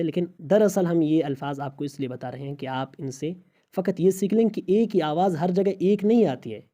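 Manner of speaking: usual speaking voice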